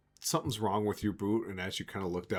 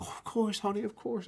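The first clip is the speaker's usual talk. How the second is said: high, sugary "oh of course, honey"; sweet cooing voice; 12 semitones higher than usual. Retold or imitated. Shopkeeper cooing sweetly at the customer, much higher. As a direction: coo it up high like a doting shopkeeper. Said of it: "And she's like".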